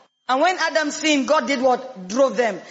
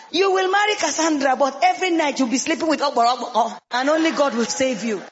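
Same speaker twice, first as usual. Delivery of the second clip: normal talking voice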